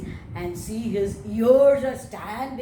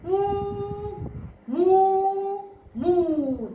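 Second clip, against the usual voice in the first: high, sung moo; louder sung moo for the children; 9 semitones higher, 4 dB louder, much slower than usual. Shown as a demonstration. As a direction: sing out long moos like a cow. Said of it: "What does the cow say?".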